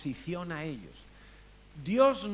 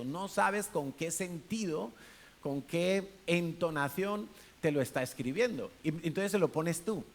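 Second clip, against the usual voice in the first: ordinary talk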